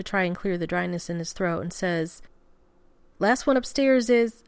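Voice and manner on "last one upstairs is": louder, higher dare; playful challenge. A narrator's reading. Groom daring his husband to race upstairs, playful challenge. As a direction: call out a race with sudden energy.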